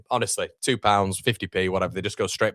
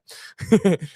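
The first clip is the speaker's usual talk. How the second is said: laugh; 11 semitones higher, 5 dB louder than usual. Real, not acted. Laughs at stream being behind.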